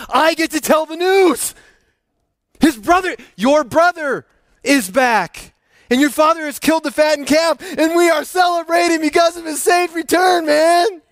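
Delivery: high, loud, thrilled rush of good news; bursting to tell the brother; excited servant. Acted.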